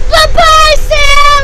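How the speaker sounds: high, loud sing-song farewell; loud goodbye. Real, not acted. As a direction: call it out to a friend leaving. Calling goodbye at bus stop.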